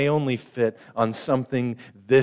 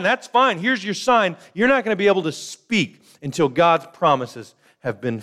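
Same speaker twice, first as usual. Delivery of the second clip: exasperated angel; 5 semitones higher than usual. Acted. Gabriel scolds doubting Zechariah.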